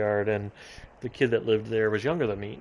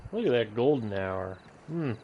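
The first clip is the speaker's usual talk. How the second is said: normal talking voice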